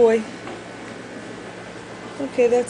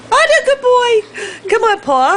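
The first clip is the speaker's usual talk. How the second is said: high, louder coax for the trick; excited coaxing; 12 semitones higher, 5 dB louder than usual. Real, not acted. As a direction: urge the dog on.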